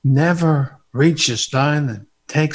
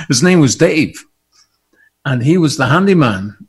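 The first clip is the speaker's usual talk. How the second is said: ordinary talk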